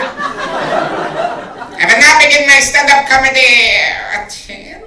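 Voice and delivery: high, slow German officer voice; German accent. Pretend. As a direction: stiff German commandant announcing. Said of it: "my impression of a Nazi stand-up comic".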